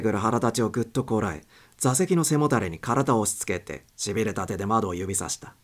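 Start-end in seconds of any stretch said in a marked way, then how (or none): none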